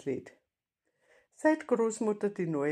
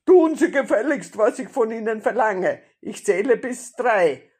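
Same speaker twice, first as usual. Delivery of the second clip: loud, raised bandit's order; shouted threat; 5 semitones higher, 13 dB louder than usual. A narrator's reading. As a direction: bark an ultimatum as the robber. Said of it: "rief der Räuber Hotzenplotz".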